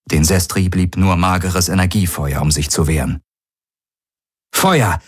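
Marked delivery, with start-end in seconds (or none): 4.5-5.0 calling out. A narrator's reading. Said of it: "rief Galen".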